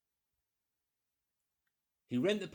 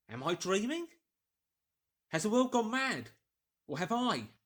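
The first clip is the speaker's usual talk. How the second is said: higher and louder, disbelieving; bewildered outburst; 7 semitones higher, 6 dB louder than usual. Acted. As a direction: ask it as if the world just tipped over. Kemp stunned after being locked out.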